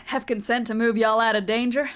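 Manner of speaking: normal talking voice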